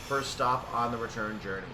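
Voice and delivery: ordinary delivery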